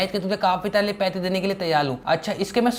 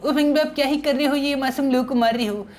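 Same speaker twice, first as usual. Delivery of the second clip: childish cartoon-boy voice, a little higher; Sinchan voice; 6 semitones higher than usual. Sinchan teasing his buddy Franky, in a childish cartoon voice. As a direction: play the cheeky cartoon kid.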